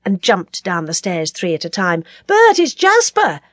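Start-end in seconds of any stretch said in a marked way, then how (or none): 2.3-3.4 high, loud, ringing greeting; delighted hail to a friend; glad shout. A narrator's reading. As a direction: call out happily and slowly to be recognised. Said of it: "hearing that glad voice ring out".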